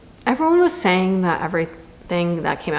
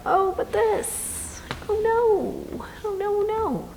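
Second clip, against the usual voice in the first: much higher, hammed-up gasping oh no; mock melodrama; 13 semitones higher, 3 dB quieter, much slower than usual. Mocking the book's constant drama for viewers, hammed-up. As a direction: parody an overwrought heroine.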